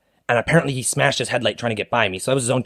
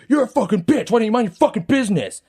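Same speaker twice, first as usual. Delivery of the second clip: high, lashing-out retold rant; acted anger; 10 semitones higher than usual. Angry driver turning on the customer, acted anger. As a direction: imitate a driver turning on a bystander. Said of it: "he went from super angry".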